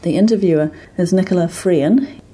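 measured, formal archive introduction; formal announcement. Acted. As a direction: read it like a formal record header. Reads the archive recording intro.

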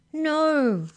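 loud, high, drawn-out cry. A narrator's reading. Maury protesting the penitentiary, crying out. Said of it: "cried Maury".

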